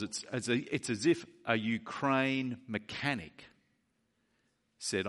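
normal talking voice

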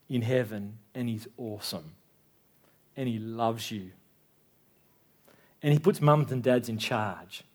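put-on gently explaining God to a two-year-old, quiet and low; gentle talk to toddler. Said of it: "I get down on eye level and I say".

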